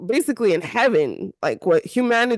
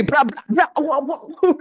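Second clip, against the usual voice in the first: brash self-parody; 4 semitones higher than usual. Retold or imitated. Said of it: "And then here's me".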